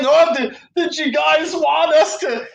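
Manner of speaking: high shouted mock voice; mocking Tony Khan. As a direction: whine it high like a sneering boss. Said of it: "And then".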